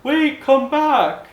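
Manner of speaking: high, loud, drawn-out call. Acted. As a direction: holler slowly after a departing boat. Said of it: "waving out at you saying".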